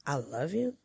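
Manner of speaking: soft, doubtful "I love you?" rising at the end; questioning tune. Shown as a demonstration. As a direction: let "you" lift into a question. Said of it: "The question mark changes the whole meaning".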